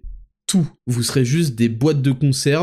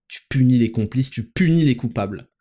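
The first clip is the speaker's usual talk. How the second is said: ordinary talk, nothing marked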